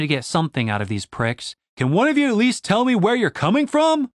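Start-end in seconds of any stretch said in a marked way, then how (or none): 1.8-4.1 calling out. A narrator's reading. Shouting after moving wagon.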